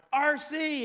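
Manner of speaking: high-pitched call down the aisle; mock holler, pitched well up; retold yell. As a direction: imitate yelling a name to someone walking away. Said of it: "I yell down".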